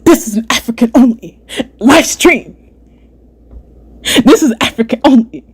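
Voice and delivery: lightly put-on orator impression; Doctor Umar. Streamer declaring an African only live stream, lightly impersonating. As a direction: declare it as a mock pronouncement. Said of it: "Doctor Umar voice".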